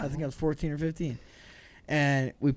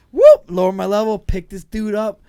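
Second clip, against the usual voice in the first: ordinary delivery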